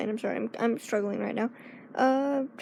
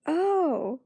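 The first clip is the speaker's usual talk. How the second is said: drawn-out higher oh; dawning realization; 5 semitones higher than usual. Retold or imitated. Friend realizing her blunder, drawn-out surprised oh. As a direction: realize suddenly, embarrassed. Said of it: "She was like".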